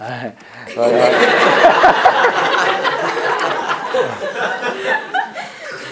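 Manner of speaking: a long high burst of real laughter. Real, not acted. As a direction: laugh out loud at the memory. Bishop's joke lands.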